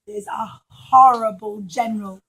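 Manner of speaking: plain reading voice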